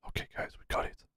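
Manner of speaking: soft whispered triumph. Real, not acted. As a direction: whisper it to the chat. Whispered success.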